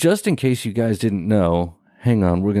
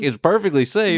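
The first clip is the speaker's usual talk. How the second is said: mocking echo; 7 semitones higher than usual. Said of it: "And I was like".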